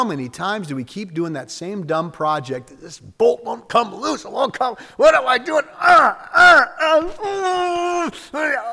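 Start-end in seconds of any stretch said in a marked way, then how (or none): 2.8-8.7 rising strained cry, much higher and louder at the end; hammed-up struggle with a stuck bolt building to wails; mock frustration.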